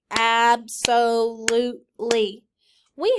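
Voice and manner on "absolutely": loud, beat by beat, ab-so-lute-ly; Absolutely pushed out in four claps; clapped syllables. Shown as a demonstration. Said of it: "Clap it".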